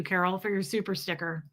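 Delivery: usual speaking voice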